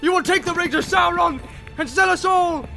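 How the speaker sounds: high, raving fury; frenzied high-pitched tirade; enraged shouting. A narrator's reading. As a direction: rant wildly, building to a curse. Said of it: "he shouted".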